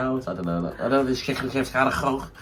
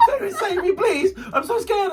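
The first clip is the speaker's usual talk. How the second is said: very high-pitched hammed-up squeal of fear, laughing; comic terrified wail; mock screaming panic; slower than usual. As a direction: imitate a friend shrieking in a panic. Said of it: "you were screaming and running around in circles".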